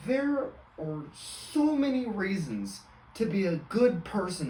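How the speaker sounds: a hint of a pious preacher; mock preachy lesson. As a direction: preach it like a syrupy life lesson. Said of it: "It's gonna be my lesson is".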